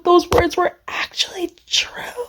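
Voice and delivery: high, breathy near-whisper; soft, emotional disbelief; awed whisper. Real, not acted. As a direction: whisper it, overcome. Overcome by the romantic lines.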